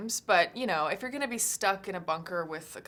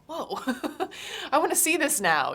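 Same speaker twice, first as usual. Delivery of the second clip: excited; 11 semitones higher, 7 dB louder than usual. Retold or imitated. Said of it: "people are like".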